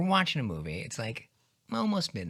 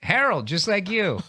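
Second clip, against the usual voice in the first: higher, lightly voiced dad; 5 semitones higher, 4 dB louder than usual. Son plays his dad giving his name, lightly put-on higher voice. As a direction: answer as a hearty dad, pitched up. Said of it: "if you did your dad voice".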